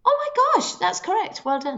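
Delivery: high, delighted burst; excited. Acted. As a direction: squeal that the answer is right. Quizmaster reacts to right answer.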